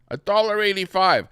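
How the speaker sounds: outraged disbelief; stunned at the price; indignant. Real, not acted.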